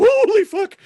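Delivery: high, loud burst of shock; startled exclamation. Retold or imitated. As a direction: imitate someone turning round in alarm. Acts out someone's startled reaction.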